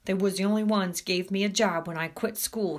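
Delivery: plain reading voice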